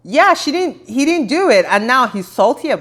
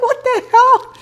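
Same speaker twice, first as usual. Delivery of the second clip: much higher, a laughing what the hell; laughing disbelief; slower than usual. Retold or imitated. Vlogger reacting to Gina's line, laughing high disbelief. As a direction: squeal it through a laugh in disbelief. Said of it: "I'm like".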